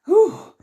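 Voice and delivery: high, loud exhaled whoop; high-pitched "whew" of exertion; winded whew. Acted. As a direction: puff out an acted breathless whew. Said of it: "so out of breath".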